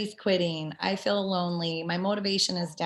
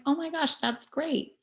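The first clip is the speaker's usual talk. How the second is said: excited; 8 semitones higher than usual. Retold or imitated. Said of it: "I'm like".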